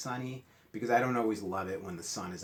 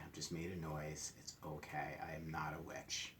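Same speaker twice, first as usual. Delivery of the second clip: whispered aside; 3 semitones lower, 9 dB quieter than usual. Real, not acted. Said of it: "unvoiced whisper".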